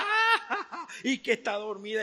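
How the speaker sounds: scornful laugh, high at the start; mocking laughter. Crowd mocking Jesus about the sleeping girl, scornful laugh. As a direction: laugh in his face with scorn. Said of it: "comenzaron a reírse a carcajadas".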